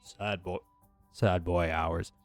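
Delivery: normal talking voice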